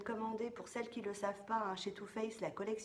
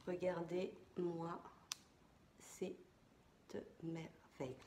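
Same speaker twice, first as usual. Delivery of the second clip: awed hush; 3 semitones lower, 5 dB quieter, much slower than usual. Real, not acted. Unveiling the new palette.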